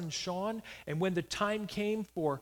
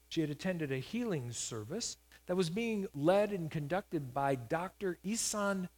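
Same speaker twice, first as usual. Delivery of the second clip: normal talking voice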